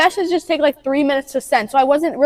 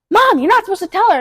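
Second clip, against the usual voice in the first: higher, protesting sibling voice; scolding; 6 semitones higher, 3 dB louder than usual. Acted. Sisters telling mom off for telling, scolding. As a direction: cut in to stop mom spilling it.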